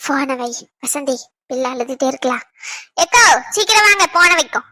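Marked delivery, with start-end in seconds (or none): 0.0-2.4 low, soft aside; hushed aside. Acted. Neighbour telling Vasanthi to hang up, hushed. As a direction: drop the voice low and keep it soft.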